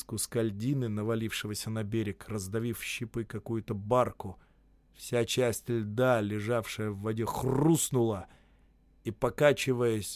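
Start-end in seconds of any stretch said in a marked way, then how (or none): none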